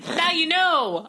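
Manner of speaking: high, drawn-out mock complaint; indignant hungry pet. Pet finally able to tell the owner, indignant. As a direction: stretch it out as an aggrieved pet. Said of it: "first thing they would say".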